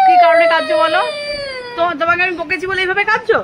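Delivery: mother confronting her sobbing son; exasperated questioning. Real, not acted. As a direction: press him to explain his crying. Asking crying child why.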